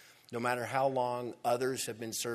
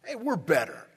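proud boast; 3 semitones higher, 7 dB louder than usual. Retold or imitated. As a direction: crow over the latecomers. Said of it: "It's pride".

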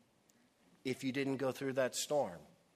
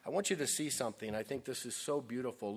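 ordinary talk, nothing marked

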